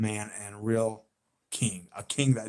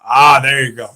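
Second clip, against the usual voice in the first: ordinary delivery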